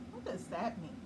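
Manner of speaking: usual speaking voice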